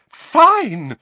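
very high, very loud surrender; furious mother's yell; shout. A narrator's reading. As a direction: shout it in exasperation. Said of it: "shouted Missus Weasley".

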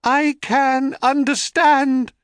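high, slow desperate echo; echoing cry. A narrator's reading. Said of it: "cried Echo".

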